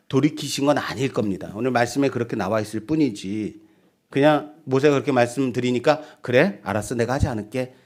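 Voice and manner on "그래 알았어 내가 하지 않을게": low, soft, offhand acted reply; casual giving-in. God casually agreeing to Moses' request, low easy giving-in. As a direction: give in easily and quietly. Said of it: "뭐 이런 느낌까지는 아닐 거예요".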